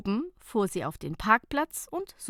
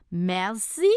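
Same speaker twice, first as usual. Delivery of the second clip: mock coquettish; 4 dB louder than usual. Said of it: "in gespielter Koketterie".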